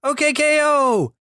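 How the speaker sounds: very high, drawn-out title cry; mock yell. Shown as a demonstration. Trying out the exclamation for co-host, mock yell. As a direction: stretch it into a high fake holler. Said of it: "you gotta yell the, the K O part".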